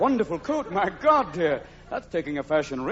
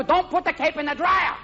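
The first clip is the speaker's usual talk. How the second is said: mock bullfighter shouting; 11 semitones higher than usual. Bullfighter yelling about his shrunken cape, high mock outrage.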